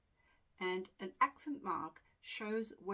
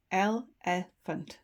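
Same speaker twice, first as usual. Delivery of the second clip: "elephant" slowly in three parts, el, e, phant; split into syllables; 9 dB louder, much slower than usual. Shown as a demonstration. Teacher reading the dictionary entry, slowly split by syllable. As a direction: break "elephant" apart with a pause after each syllable. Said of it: "It is split".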